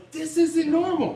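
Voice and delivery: bewildered aside. Retold or imitated. Former teammate recalling his reaction to a wild player, mild bewilderment. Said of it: "I'm sitting there like".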